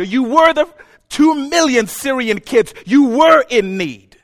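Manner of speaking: loud, high, emphatic preaching; impassioned preaching. Real, not acted. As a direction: preach it at full force, hammering key words. Preaching at full force.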